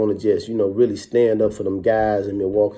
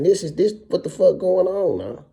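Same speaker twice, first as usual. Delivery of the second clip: higher and louder, reliving the shock; retold disbelief; 10 semitones higher, 5 dB louder than usual. Prisoner on the bus reacting to armed guards, stunned and higher.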